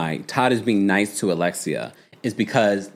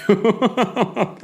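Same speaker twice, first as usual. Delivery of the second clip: laughing through the punchline; 10 semitones higher than usual. Real, not acted. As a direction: break into a high laugh. Laughs at own joke.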